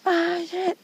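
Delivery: high-pitched imitated curse; mock cursing. Caller curses her on the phone, mock fury. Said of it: "Dia maki".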